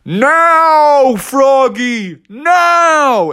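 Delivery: high, drawn-out urgent yell; long, high coach's shout. Acted. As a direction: holler the signal from the corner. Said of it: "he heard Bucky yelling".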